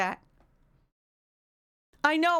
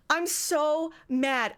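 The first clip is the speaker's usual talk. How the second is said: ordinary talk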